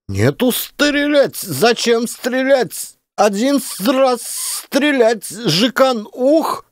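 high, slow, shouted broken Russian; boy yelling. A narrator's reading. Boy yells at the NKVD men about shooting. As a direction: yell it up high at them. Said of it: "заорал пацан на них".

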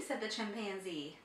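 ordinary delivery, nothing marked

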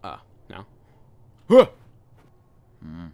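ordinary delivery

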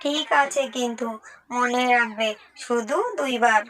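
put-on small, soft child's voice pleading with her mother; little daughter's voice. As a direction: coax mum gently, like a little girl. Narrator voices the daughter again.

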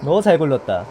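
loud gleeful gotcha; gloating shout. Acted. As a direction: yell it like you caught them. Catching survivor.

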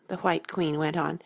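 usual speaking voice

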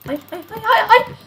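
builds from a high thin plea to a loud high wail; panicked no. Real, not acted. Streamer about to fall off, rising panicked wail. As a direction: panic as it slips away. Falling in the game.

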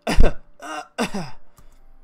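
loud hacking cough; a sudden loud coughing fit; harsh acted cough. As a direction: cough hard like a sick mother. Sick mother coughing.

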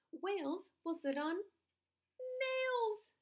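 high frog, leaping up on the last word. Acted. Frog answering the dog, jumping high on the rhyme.

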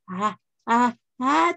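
building up to a sneeze; gasping before a sneeze; sneezing. Real, not acted. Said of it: "Oh, I was gonna sneeze".

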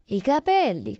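ordinary delivery

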